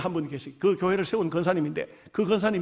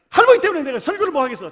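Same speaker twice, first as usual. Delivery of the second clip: exasperated scolding; 6 semitones higher, 6 dB louder than usual. Retold or imitated. Pastor scolding dozing grandmother.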